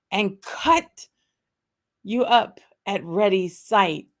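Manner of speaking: slow, grand reading of the Burns address; theatrical poem recital. Acted. Host reciting the haggis address to viewers, slow theatrical declaiming. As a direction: declaim it slowly with mock gravity.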